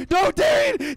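high desperate yell; panicked shout. Real, not acted. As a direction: scream his name in panic. Chased, yelling at teammate.

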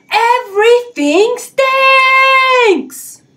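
very high, slow, loud wail; drawn-out wail. Acted. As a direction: stretch it out in despair. Jim wails his complaint.

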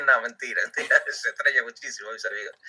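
chuckling through a joking retraction; amused, laughing correction. Real, not acted. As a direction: laugh while taking the joke back. Jokes friends are drunks, laughs.

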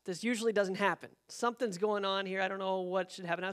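ordinary talk, nothing marked

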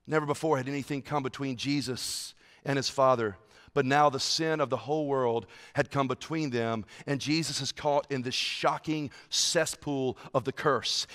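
soft, low and grave; hushed solemn preaching. Acted. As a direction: speak it like a quiet confession. Hushed passage on separation.

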